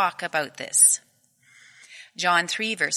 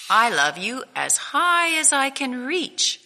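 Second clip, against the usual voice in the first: higher, eager little bunny voice; louder, higher young hare boasting; 7 semitones higher, 5 dB louder than usual. Acted. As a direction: reach up high with it like an eager small child. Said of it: "said Little Nut Brown Hare".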